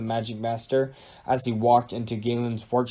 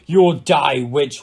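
loud, raised-pitch battle cry; roared threat; 3 semitones higher, 9 dB louder than usual. A narrator's reading. King charging at the mean-looking woman, roaring. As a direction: roar it out at the enemy. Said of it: "roared King Henry".

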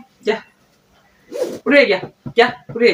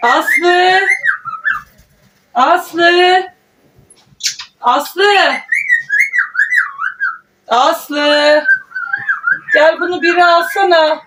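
calling a name across the house, pitched up; 7 semitones higher, much slower than usual. Real, not acted. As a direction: call her name again and again. Calling daughter from another room. (yüksek sesle seslenerek)

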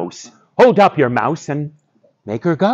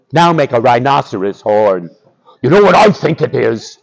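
ordinary delivery, nothing marked

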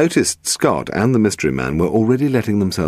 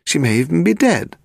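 high-pitched, agitated warning; heated; 11 semitones higher than usual. A narrator's reading. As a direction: press the worry on him heatedly. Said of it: "Steve said heatedly".

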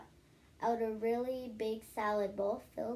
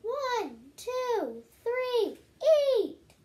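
much higher, louder countdown call; judge yelling; 12 semitones higher, 9 dB louder, much slower than usual. Acted. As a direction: call the contest start like a judge. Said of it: "The judge yelled".

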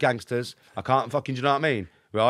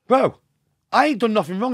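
alarmed protest; 10 semitones higher, 9 dB louder than usual. Retold or imitated. Protesting innocence to mafia boss.

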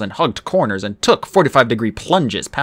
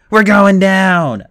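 high, loud, slowed strain; straining Grover; 8 semitones higher, 7 dB louder, much slower than usual. Acted. Grover struggles to hold Percy and Annabeth, straining. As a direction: push it out as if lifting too much. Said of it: "Grover said".

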